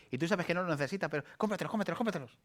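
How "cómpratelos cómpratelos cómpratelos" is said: much higher singsong "cómpratelos"; high mock pestering inner voice; nagging soul. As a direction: needle them like a pestering voice. Soul keeps urging.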